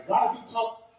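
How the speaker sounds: ordinary talk, nothing marked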